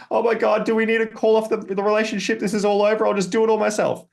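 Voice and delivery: an overwhelmed wife blaming her partner; accusing wife. Shown as a demonstration. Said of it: "It'll be like".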